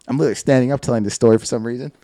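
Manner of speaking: normal talking voice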